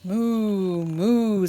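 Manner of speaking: loud, long-drawn mooing; big sustained cow call; cow's moo. Acted. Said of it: "said the cow".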